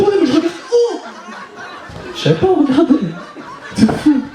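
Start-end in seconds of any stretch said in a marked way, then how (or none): 0.0-1.0 much higher panicked question; lost, squeaky where do I look; mock panicked reply; confused self. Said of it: "Je fais".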